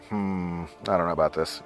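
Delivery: low, soft, hesitant hum and objection; doubtful dad. Retold or imitated. Dad unsure about his kid's request, low and doubtful. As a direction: imitate a dad quietly having his doubts. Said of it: "my dad would always be like".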